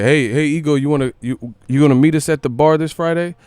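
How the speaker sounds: a hint of a buddy shouting an invite. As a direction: friend inviting Ego to the bar. Friend inviting him out.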